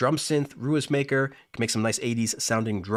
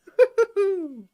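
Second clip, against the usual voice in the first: high-pitched real laugh; 6 dB louder than usual. Real, not acted. Streamer amused by years-long wait for a feature, high laugh. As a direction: let out a loud, high giggle.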